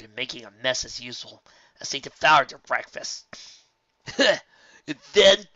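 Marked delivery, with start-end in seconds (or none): 4.1-5.5 loud, high rowdy colt; laughing boast. Acted. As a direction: crow about the prank, laughing. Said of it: "Scorch laughed".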